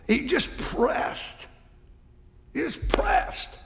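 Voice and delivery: high, slow pained cry; slow anguished preacher's lament; pained preaching. Acted. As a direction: ache through the words like a man pressed down. Preacher voicing prophet's agony.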